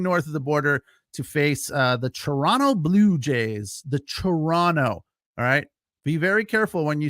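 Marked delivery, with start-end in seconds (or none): 2.1-2.7 Toronto with a silent second T; local pronunciation. Shown as a demonstration. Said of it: "only one T in Toronto". 3.9-5.0 Toronto drawn out as "tuh-RAH-no"; only the first T sounded in Toronto; local pronunciation. Shown as a demonstration. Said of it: "only one T in Toronto".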